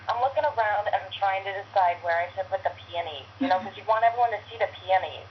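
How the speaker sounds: put-on Brooklyn landlady accent; Phyllis. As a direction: talk like a fussy Brooklyn landlady. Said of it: "she's, like, so Brooklyn".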